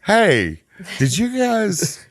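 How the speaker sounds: imitating a puzzled neighbor. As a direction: stretch it out like a neighbor checking in. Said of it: "The neighbors are all gonna come out".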